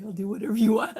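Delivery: loud laughing promise; laughing dog voice. Pretend. The dog eagerly promising her, laughing loudly. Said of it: "And he's like".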